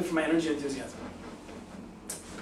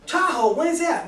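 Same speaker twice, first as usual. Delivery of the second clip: excited question; 9 semitones higher than usual. Shown as a demonstration. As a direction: jump on it with excitement. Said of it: "like I've just demonstrated with you right".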